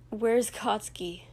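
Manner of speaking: ordinary delivery, nothing marked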